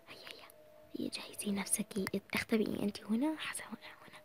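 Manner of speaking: breathy hiding whisper. Acted. Sister telling her sister where to hide, whispering. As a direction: keep it down while hiding.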